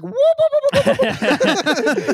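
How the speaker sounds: high, loud, slow squealing whoops; frantic cartoon crab whooping; Zoidberg whoop. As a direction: flail away like Zoidberg. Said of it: "doing Zoidberg".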